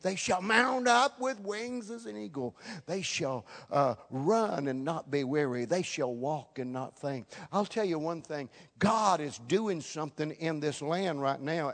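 loud, rising preacher's exhortation; full-force preaching. Acted. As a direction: lift the voice and drive it to the congregation.

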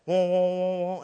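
pulsing wah-wah of an untuned string; imitated wavering beats. Tuner hits the note for the pastor, wavering drone. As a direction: waver like two clashing piano strings. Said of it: "Yeah, now listen".

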